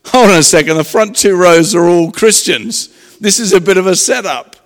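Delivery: catching on to the setup and calling it out; loud realization. Retold or imitated. To himself in the crowd, realizing the setup, loudly.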